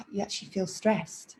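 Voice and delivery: sighed, almost whispered; breathy demo sigh. Shown as a demonstration. Teacher shows how stressed breathing feels, breathy sigh. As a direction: let the words ride out on a sigh. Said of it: "if you start breathing like this".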